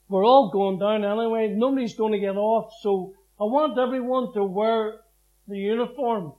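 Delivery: loud rallying call to the men in the dock; loud rallying call to fellow prisoners; calling out to the guys. Retold or imitated. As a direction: rouse the gang, loud and firm. Rallying co-accused before trial.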